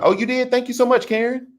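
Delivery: high, bright-eyed gushing thanks; light up and gush your thanks; excited thanks. Real, not acted. Streamer thanking a new Patreon supporter, high and gushing.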